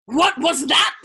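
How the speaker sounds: loud, high roar of disbelief; incredulous roar. A narrator's reading. General roaring at the interrupting officer in disbelief. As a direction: roar it in outrage. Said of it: "roared General Dreedle incredulously".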